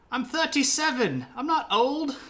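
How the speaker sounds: very high, loud protest breaking into laughter; laughing indignant peasant. Retold or imitated. Dennis insisting on his age, high and laughing. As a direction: protest while cracking up. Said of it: "Dennis protesting, laughing".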